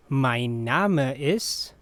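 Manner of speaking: slow, careful, left hanging; unhurried teaching model with rising end; slow repeat. Shown as a demonstration. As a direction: stretch it out for learners. Teacher repeats slowly for learners.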